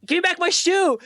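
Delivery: high-pitched retold demand; hammed-up cry for it back; mock indignant yell. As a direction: call it out high, imitating outrage. Acting out man with stuck shoe.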